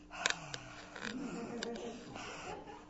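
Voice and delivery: long soft sigh out; calming sigh. Shown as a demonstration. Showing kids the cool-down seat, soft calming sigh. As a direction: let out a slow calming breath. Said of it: "and you go".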